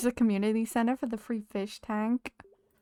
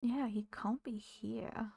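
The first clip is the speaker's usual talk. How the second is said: slow breathy whisper to herself; 8 dB quieter, slower than usual. Real, not acted. Gamer whispering to herself about a missing villager, slow whisper. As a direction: say it under your breath. Muttering to self, unvoiced.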